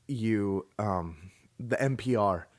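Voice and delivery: normal talking voice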